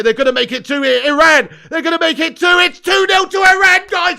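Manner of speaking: rising from eager to full goal shout; building goal yell. Real, not acted. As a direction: build the hype until you roar the goal. Iran attack, then score again.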